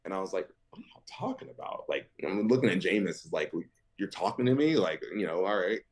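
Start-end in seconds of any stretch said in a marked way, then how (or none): 0.7-1.9 high, fast, breathless whisper; confused whisper. Retold or imitated. Reacting to teammates teasing him, whispered. 4.0-4.8 higher, incredulous question; surprised. Retold or imitated. Asking Jameis if he means him, surprised.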